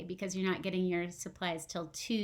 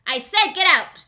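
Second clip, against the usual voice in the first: fisherman's loud angry yell; 6 semitones higher, 14 dB louder than usual. Acted. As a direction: bark at the dog to get out. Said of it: "the fisherman yelled".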